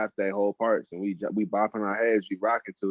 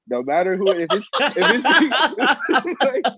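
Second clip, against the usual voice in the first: breaking into laughter, higher pitch; high, louder, laughing through the words; 11 semitones higher, 5 dB louder than usual. Real, not acted. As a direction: crack up while trying to get the words out. Cracks up mid-sentence about [name].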